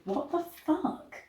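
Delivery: high, hushed, incredulous; shocked aside. Real, not acted. Reader breaks off, stunned by the story. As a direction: blurt it under your breath, stunned. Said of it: "reader reacting to text".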